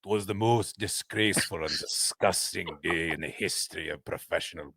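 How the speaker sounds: low, slow mock Scottish golfer; Scottish accent. Reading the Sam Torrance quote in a low, slow Scottish accent. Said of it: "any issues with that accent".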